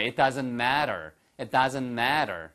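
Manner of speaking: matter said the American way with a flapped t, mad-er; slow American model of matter; American-accent demo of matter; American pronunciation. Shown as a demonstration. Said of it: "한번 미국식으로 한번 해보실까요".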